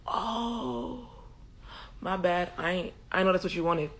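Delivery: very soft comic apology; sheepish backing down. Retold or imitated. Priest realises she was praying, sheepishly soft. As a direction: shrink into a small, quiet "my bad". Eli backs down after her answer.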